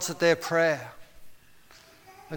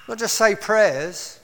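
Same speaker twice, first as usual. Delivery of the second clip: usual speaking voice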